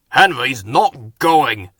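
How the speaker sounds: slow, heavy defiant engine voice; defiant Gordon. A narrator's reading. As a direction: drag each word out in refusal.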